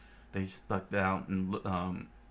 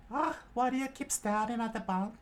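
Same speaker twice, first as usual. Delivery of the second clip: much higher curious question; high character voice. Acted. Johnny asking Nami about the bounties, high. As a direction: ask her curiously.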